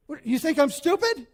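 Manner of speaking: indignant wife. Retold or imitated. Wife demands if husband thinks she's stupid, indignant. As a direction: throw the accusation back at him. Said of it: "And she says".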